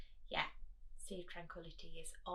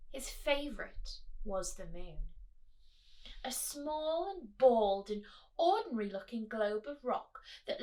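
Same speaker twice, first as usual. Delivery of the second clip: higher, animated storybook voice; reading to children; 4 semitones higher, 6 dB louder, slower than usual. Acted. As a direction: draw the kids into the story. Reading picture book aloud.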